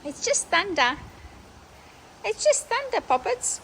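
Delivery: high, gentle reassuring coo; calm the frightened dogs; soothing a pet. Real, not acted. Soothing dogs during thunder.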